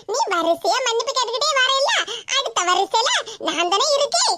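ordinary talk

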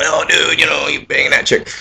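lightly voiced dude-bro fan. Quoting fans at events, soft mock bro. Fans quoted about sex stuff.

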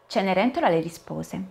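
plain reading voice

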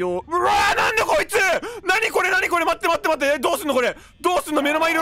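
high, loud panicked shouting; real panic. Real, not acted. Gamer facing a sudden monster, panicked shouting. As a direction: shriek in alarm, words tumbling out. Animatronic suddenly attacks her in game.

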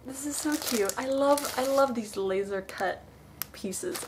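ordinary talk

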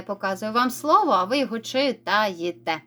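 ordinary delivery

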